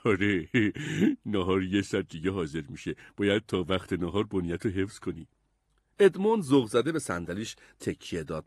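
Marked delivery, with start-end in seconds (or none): 0.0-5.3 low, jolly sailor voice; easygoing fat sailor, lower register; laughing sailor. A narrator's reading. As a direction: answer with a chuckle, pitched down. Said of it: "ملوان خندید و گفت".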